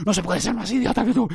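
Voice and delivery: high, strangled put-on rage; mock angry. Podcaster acting out his fight with a listener, mock furious. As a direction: squeal it up into a mock threat. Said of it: "De repente se ha enfadado de verdad".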